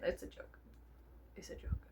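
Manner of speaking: whispered twice; a sheepish whispered disclaimer. Real, not acted. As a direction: breathe it under your breath. Whispered repeat.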